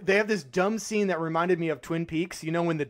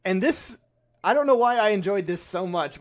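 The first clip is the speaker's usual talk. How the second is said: ordinary talk, nothing marked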